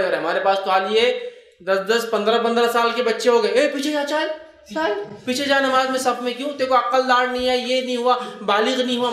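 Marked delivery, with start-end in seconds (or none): 3.5-9.1 scolding elder. Retold or imitated. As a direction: shove the boy back in the row. Elders shooing boys away. (مسجد کے بزرگ کی ڈانٹ، اونچی آواز میں)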